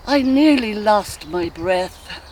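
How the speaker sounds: loud, high, slow exclamation; swept away. Acted. Molly remembering a long kiss, rapturous outburst. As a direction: draw it out loudly, carried away by the memory.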